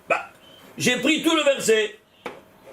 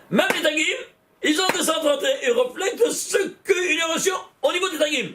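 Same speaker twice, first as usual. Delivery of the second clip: much higher, rising in delight over "tagim"; excited exclaiming; 8 semitones higher, faster than usual. Real, not acted. Teacher thrilled showing students the tagim total, excited higher call. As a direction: announce the discovery like a revelation.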